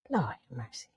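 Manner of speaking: unvoiced, exasperated whisper; a real whispered sigh of disbelief; whispered aside. Real, not acted. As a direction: breathe it out like you've given up on her. Exasperated aside after crying clip.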